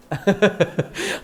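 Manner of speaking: short run of soft chuckles; servant's laugh. Acted. Servant laughs while people scold him, soft mock chuckle. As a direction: chuckle quietly in spite of the telling-off.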